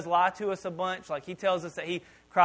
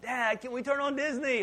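put-on kid voice; 7 semitones higher than usual. Kids asking dad.

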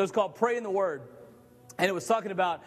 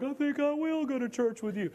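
high, dazed mock surrender; dazed big guy; 6 semitones higher than usual. Big guy being dragged off, dazed and beaten. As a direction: sound knocked silly.

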